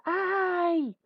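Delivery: high, drawn-out mock yelp; cry of pain. Shown as a demonstration. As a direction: demonstrate someone crying out "ay". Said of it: "Cuando alguien grita". (grito de dolor fingido)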